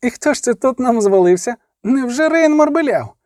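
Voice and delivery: high, boyish mock astonishment; mocking youngster. A narrator's reading. Youngest brother over the fallen captive, mocking youngster. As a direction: tease him with a high, rising voice.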